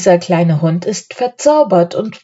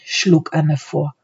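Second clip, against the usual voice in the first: ordinary delivery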